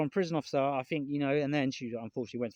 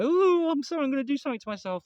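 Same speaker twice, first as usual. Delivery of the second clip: much higher, louder, hammed-up wail; mock despair; 12 semitones higher, 6 dB louder than usual. Mocking the despairing message viewers might expect from him, hammed up. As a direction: parody a gloomy goodbye, rushing it. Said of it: "rather than, you know".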